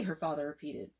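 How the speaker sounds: usual speaking voice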